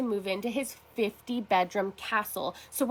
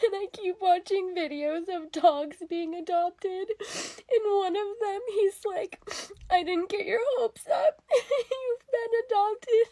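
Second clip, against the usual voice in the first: higher, teary voice, crying and laughing at once; laughing through tears; 8 semitones higher, 3 dB quieter than usual. Real, not acted. To the camera about adoption videos, teary laugh-crying. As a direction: choke up and giggle through it. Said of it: "I'm not crazy, I swear".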